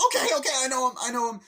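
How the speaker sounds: high, loud frantic giving in; panicked surrender. Retold or imitated. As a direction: blurt it out to save his brother.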